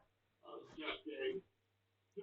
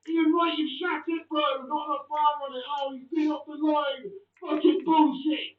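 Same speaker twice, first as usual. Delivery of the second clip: loud, raised, annoyed ranting; angry rant; 7 semitones higher, 6 dB louder than usual. Real, not acted. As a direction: vent at the striker. Ranting at missed shot.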